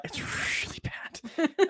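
slow breathy whisper of doom; ominous whisper. Real, not acted. Friend narrating the movie, whispering the dire outcome slowly. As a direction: whisper it like a ghost story. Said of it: "whispered ominous aside".

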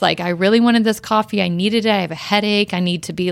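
ordinary talk

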